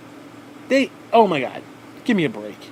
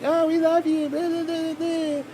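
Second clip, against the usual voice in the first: much higher, gushing mock girl voice; swooning teen girls; 12 semitones higher, slower than usual. Vlogger imitates teen girls gushing over him, high. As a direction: gush like a smitten teenager. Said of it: "they're like".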